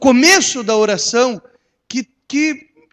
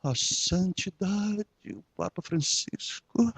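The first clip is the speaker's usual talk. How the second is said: hushed, slow, reverent reporter; 3 semitones lower, 12 dB quieter, slower than usual. Retold or imitated. Pastor mocking reporters on the Pope, hushed slow reverence. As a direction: mimic a reporter bowing to the Pope. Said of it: "Vão falar do Papa?".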